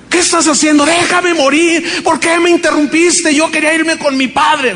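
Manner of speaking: high, upset reproach; woman reproaching her husband for waking her; distraught protest. Retold or imitated. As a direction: lash out at being woken.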